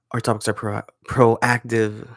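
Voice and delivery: radio announcer voice, lightly put on. Shown as a demonstration. Retrying the show intro with a hurting throat, radio voice. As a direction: attempt a measured radio read. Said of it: "I can't really do that voice that long".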